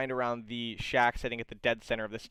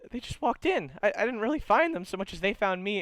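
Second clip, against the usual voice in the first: higher, quick sheepish explanation; sheepish guide; 8 semitones higher, faster than usual. Acted. Guide explaining how he met the party, high quick sheepish. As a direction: rush through it, a bit embarrassed. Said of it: "Q goes".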